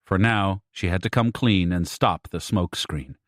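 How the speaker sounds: plain reading voice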